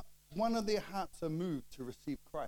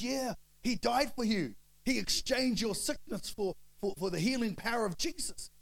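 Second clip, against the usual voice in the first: loud, higher-pitched fervent plea; zealous convert; 7 semitones higher, 4 dB louder, slower than usual. Retold or imitated. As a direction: re-enact urgent preaching at your mother. Retold fervent preaching at mum.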